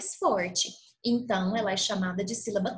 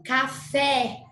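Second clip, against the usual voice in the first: ordinary delivery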